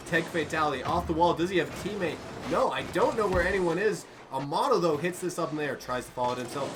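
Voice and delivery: animated esports commentary; live play-by-play. Real, not acted. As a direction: call the scramble as it happens, high and loud.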